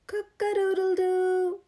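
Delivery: loud, high rooster crow. A narrator's reading. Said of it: "crowed Rooster".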